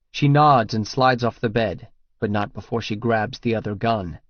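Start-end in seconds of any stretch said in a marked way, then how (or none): none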